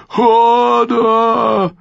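high, loud, drawn-out roar; bellowing. A narrator's reading. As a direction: roar in panic at the thunder. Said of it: "brüllte der Stallbursche". (lautes, langgezogenes Brüllen)

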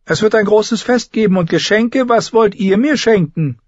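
put-on high, haughty boy-king voice; young king Joffrey. A narrator's reading. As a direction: look her over like a spoilt king. (hoher, hochmütiger Knabenkönig)

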